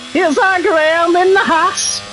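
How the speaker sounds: a hint of [name] hosting a show. A touch of a TV hostess introducing Graham, high voice. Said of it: "only literally the surprise, surprise".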